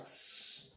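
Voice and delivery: "s-" held as a long hissed consonant; letter sound of S. Shown as a demonstration. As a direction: sound out "s-" with no vowel. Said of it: "S, par exemple".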